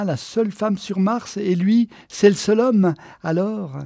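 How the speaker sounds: high, loud, mounting glee; excited reasoning. Acted. Geneviève tells Walter her plan as Mars's only woman, excited. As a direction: build towards the obvious conclusion.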